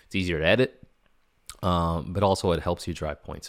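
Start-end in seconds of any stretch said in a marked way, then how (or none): none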